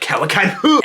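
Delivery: mock anime fight shout; anime fighter. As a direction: call it out like an anime attack move. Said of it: "something an anime character says in a fight".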